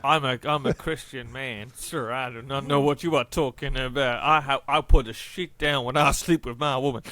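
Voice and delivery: loud, hammed-up Southern American accent; jokey loud American accent, like a Southern gentleman; mock American accent. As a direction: play a loud, pious Southern American churchgoer. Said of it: "like, a Southern accent or just a generic, like, American accent".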